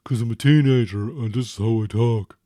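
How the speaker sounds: put-on grumbling teen brushing off his father; sulky teenage son. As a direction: play the moody teen who's fed up with his dad. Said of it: "Why are you talking like that?".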